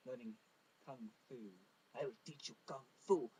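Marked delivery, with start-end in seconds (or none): 1.9-3.3 a lightly done bad Chinese accent. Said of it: "terrible Chinese accent".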